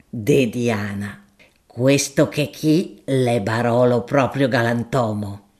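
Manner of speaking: a deferential, earthy shopkeeper making his offer; grocer Gaudenzio. Acted. Gaudenzio praises wine.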